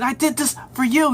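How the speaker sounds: high-pitched mock yell; retold yelling. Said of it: "he's yelling at him".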